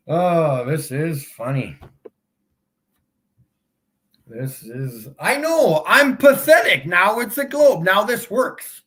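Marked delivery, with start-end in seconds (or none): 5.2-8.8 high, loud mock concession; sarcastic agreement. Streamer echoing a commenter's insult, sarcastic. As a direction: pretend to give in, pitched up and punchy.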